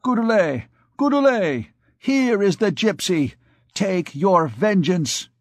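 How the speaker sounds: high, calling out Goudelet by name; loud call. Acted. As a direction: priest summoning the recluse, called out loud. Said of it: "he cried in a loud voice".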